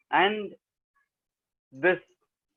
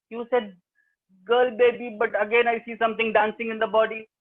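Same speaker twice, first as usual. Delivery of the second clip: put-on higher boyish complaint; lightly voiced disappointed brother; 6 semitones higher than usual. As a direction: whine it like a let-down brother. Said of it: "again he says".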